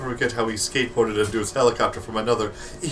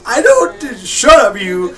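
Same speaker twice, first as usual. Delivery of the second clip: mock shout; 11 semitones higher, 9 dB louder, slower than usual. Real, not acted. Playful quarrel at the table.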